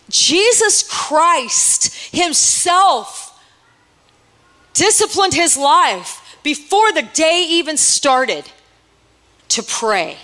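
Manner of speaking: Jesus Christ himself stretched out very slowly; slow, weighty preaching. Acted. Preacher slowing down to stress Jesus' discipline. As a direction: lean into every word for weight.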